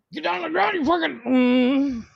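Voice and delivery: high-pitched mock cop shout, trailing into a hum; re-enacted police yell. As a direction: replay the punchline as a cop's yell. Retells shouting punchline at school.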